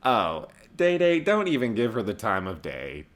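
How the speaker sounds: normal talking voice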